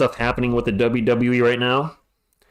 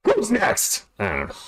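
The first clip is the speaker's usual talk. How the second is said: Goldberg impression; 7 semitones higher, slower than usual. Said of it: "Uh, Goldberg?".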